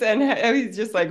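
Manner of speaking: ordinary talk, nothing marked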